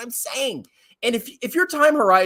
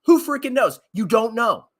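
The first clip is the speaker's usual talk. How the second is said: usual speaking voice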